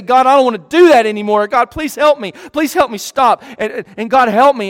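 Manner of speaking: a believer begging God to stop sinning, pleading; pleading prayer. Pretend. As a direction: beg God for help, voice pushing. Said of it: "And you say".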